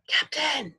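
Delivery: ordinary delivery, nothing marked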